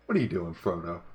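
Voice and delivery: ordinary talk, nothing marked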